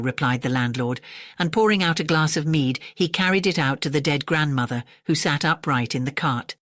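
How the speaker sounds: plain reading voice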